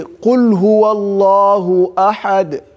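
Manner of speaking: quick chanted Quran recitation; teacher reciting a verse to show the stop. Shown as a demonstration. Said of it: "Let's say".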